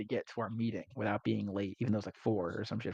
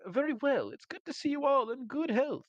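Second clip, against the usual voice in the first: much higher, grand greeting; high posh noble; 13 semitones higher than usual. Retold or imitated. Rich host Faust greeting guests at dinner, grand higher voice. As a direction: play the pompous rich host.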